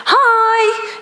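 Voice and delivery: high, sing-song greeting, mocking the team; imitating an irritating sing-song hello. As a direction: chirp it like an annoyingly cheery caller. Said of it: "in this kind of really irritating sing-song voice".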